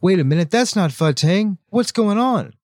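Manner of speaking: high-pitched imitation of an indignant crowd; mock crowd shouting. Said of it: "like, shouting".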